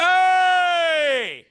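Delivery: very high sustained ringing hey; relaxed booming call. Shown as a demonstration. Coach showing the room how crazy it sounds, booming. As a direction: let a long hey ring out. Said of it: "you see, that's crazy".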